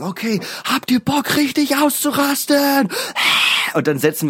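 high, slow rock-show hype call; stage hype voice. Pretend. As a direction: pump up the crowd like a frontman. Pretend frontman hyping the crowd.

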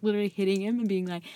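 ordinary talk

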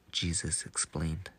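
plain reading voice

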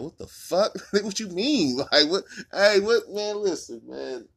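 high, loud indignant voice with laughs; imitating the dead man's ghost. A murdered man's spirit facing the cop, high loud outrage. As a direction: squawk it like a baffled ghost. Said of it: "he gonna be looking at you like".

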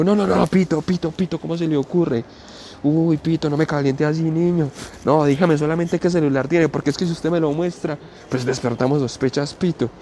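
high, jittery pleading thief's voice; a jumpy thief hushing him anxiously; nervous robber. Retold or imitated. A nervous robber begging Pito not to show the phone, high and jittery.

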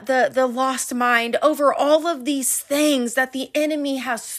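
fervent preaching, building loud. Acted. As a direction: preach it with fire. Preaching at full force.

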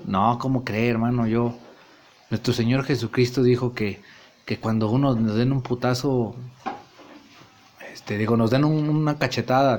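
low, soft repentant murmur; meek apology. Acted. Nacho apologizes to Montes with a soda, low and soft. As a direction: hush it down like a reformed man. Nacho quoting Jesus softly.

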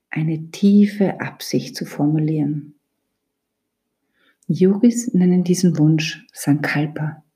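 ordinary talk

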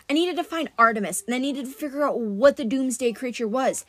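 usual speaking voice

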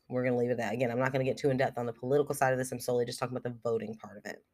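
usual speaking voice

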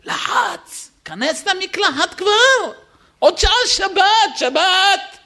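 very high, strained urging; pressured nagging. Acted. Father pressuring his son to shower before Shabbat, very high and tense. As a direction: push him into the shower, panicked.

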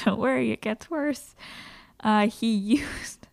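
laughing nervously through the words; uneasy giggle while telling it. Real, not acted. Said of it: "Don't, no nervous laughing".

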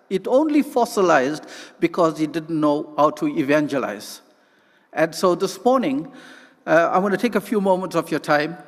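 normal talking voice

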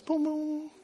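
high, soft sound-effect voice; imitated light-bulb sound. Preacher acting out a light bulb moment, high soft sound effect. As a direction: make a small high-pitched switching-on noise.

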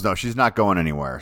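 ordinary talk, nothing marked

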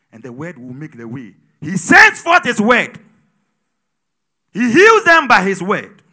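fervent sermon delivery; loud, rhythmic pulpit preaching. Acted. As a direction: preach it with rising fire. Preaching at full force.

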